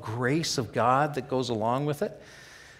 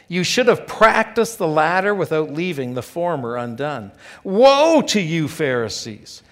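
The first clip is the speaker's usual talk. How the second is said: preaching at full force; 2 semitones higher, 7 dB louder than usual. Acted. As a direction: thunder the woes at the Pharisees. Reads the woes aloud.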